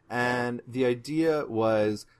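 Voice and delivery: ordinary delivery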